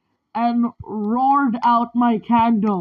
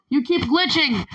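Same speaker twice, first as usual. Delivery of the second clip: ordinary delivery, nothing marked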